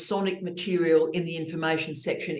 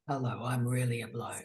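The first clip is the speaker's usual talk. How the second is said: proper radio bloke voice; 6 semitones lower, 7 dB quieter than usual. Retold or imitated. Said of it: "Speaking very properly".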